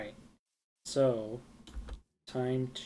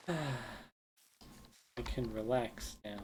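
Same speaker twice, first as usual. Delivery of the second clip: relieved sigh; 3 dB quieter, much slower than usual. Real, not acted. Relief after finding saplings.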